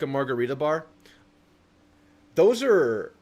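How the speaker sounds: ordinary talk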